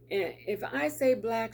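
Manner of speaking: normal talking voice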